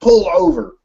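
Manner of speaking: loud, high Southern cop yell; Southern cop shouting. Acted. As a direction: bark it like a Southern sheriff on a loudspeaker. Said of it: "a thick Southern accent over a loudspeaker".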